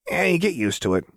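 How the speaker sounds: high, careless shrugging tone; Jinx voice. Acted. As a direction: toss it off lightly.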